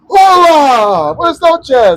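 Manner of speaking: loud, high, drawn-out mock hello; booming greeting. Older stranger greets him on the patio, booming. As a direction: holler an exaggerated Spanish greeting.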